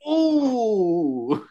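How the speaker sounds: long, high wincing "ooh". Retold or imitated. Recalling seeing Roman hit by the ball, wincing. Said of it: "I saw that. I was like".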